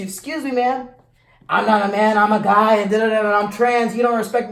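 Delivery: loud, high hammed-up rant; mock angry rant. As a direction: overreact in mock outrage. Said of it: "That could turn into".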